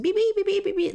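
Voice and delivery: high robotic beeps, mindless copying; mock a parrot repeating by rote; parrot beeping. Shown as a demonstration. Teacher mocking mindless parroting with beeps. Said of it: "as if you were parroting the person".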